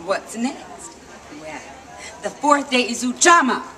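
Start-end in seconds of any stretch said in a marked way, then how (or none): none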